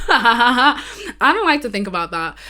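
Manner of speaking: said through a laugh, a touch embarrassed. Real, not acted. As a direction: laugh it out, a bit sheepish. Repeats line, second time laughing.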